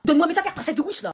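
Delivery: furious sibling yell; angry shout. Acted. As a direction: shout the demand angrily. Sibling quarrel, yelling.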